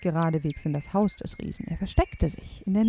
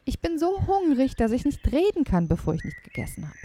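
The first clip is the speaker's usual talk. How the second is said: lightly voiced hungry boy; a hint of a begging child; pleading boy; 7 semitones higher than usual. A narrator's reading. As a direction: plead a little for food.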